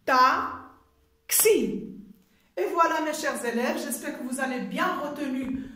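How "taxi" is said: TAXI in two beats, ta and xi; syllable by syllable. Shown as a demonstration. Teacher tapping out two syllables, said separately. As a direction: leave a clear gap inside taxi. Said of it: "Deux syllabes".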